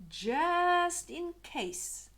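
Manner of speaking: slow, high, loud; dramatic slow emphasis. A narrator's reading. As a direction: draw it out for suspense.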